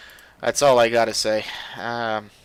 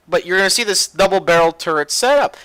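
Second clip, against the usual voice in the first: ordinary delivery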